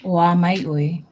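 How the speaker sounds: slow clear "Oa mai oi", "mai" rising then "oi" falling; teacher giving the example; model example. Shown as a demonstration. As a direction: demonstrate "Oa mai oi" slowly. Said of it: "give us an example".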